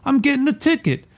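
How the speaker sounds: high, clipped driver voice; curt driver. Acted. Reading the novel, the driver answers the bodyguard curtly. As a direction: bite it off, short and curt. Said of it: "The driver said curtly".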